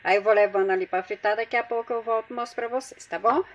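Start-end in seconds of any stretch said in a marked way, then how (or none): none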